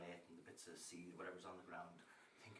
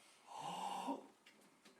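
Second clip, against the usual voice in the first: big drawn-in gasp, higher; shocked gasp; 9 semitones higher than usual. Acted. Woman learning she has sinned, shocked gasp. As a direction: gasp in horror.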